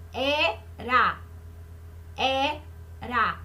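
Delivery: "Era" split in two, pause after E; syllable by syllable. Shown as a demonstration. Teacher showing pupils how often the mouth opens, "Era" broken into syllables. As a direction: say "Era" as two separate beats, E, then ra. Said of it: "eu abro a boca quantas vezes".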